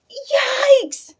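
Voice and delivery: high, loud shriek of alarm; yelled yikes. A narrator's reading. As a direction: shriek it in horror. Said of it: "yelled her mother".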